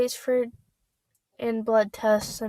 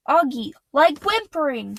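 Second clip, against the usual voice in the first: impatient; 4 semitones higher, 5 dB louder than usual. Acted. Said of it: "she said impatiently".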